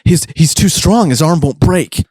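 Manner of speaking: high, strained complaint; frantic exertion. Acted. Best turns to his friend for help, frantic. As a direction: stumble it out mid-struggle.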